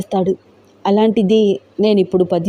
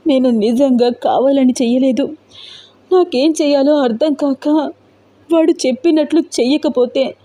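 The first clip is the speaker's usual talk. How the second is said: choked up; 5 semitones higher than usual. A narrator's reading. Roopa confesses the threats to Teja, voice choking and higher. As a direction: voice choking while admitting it. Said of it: "రూప గొంతు పూడుకుపోతోంది".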